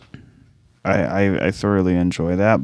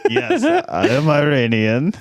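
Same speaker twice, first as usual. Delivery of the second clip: Iron Sheik impression; 7 semitones higher than usual. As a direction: ham up a Middle Eastern accent. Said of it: "start talking like the Iron Sheik".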